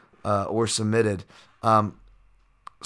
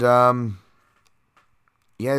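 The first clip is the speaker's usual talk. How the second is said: ordinary talk, nothing marked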